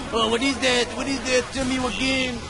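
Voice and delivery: imitating Snow. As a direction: mimic a friend who never gets it. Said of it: "But you tell Snow".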